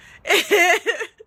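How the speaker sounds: sudden high laugh. Real, not acted. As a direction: burst of high bright laughter. Amused aside mid-story.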